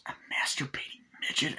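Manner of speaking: whispered, soft acted disbelief; hushed amazement. He looks up and sees Chucky, amazed stage whisper. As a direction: whisper it as if stunned by the sight. Character spots a silhouette.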